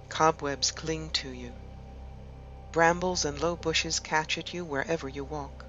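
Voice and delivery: measured meditation voice with long pauses between phrases; guided meditation. Acted. Host guiding listeners to relax and imagine an ancient wood, guided meditation. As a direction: lead them gently inward, leaving space after each phrase.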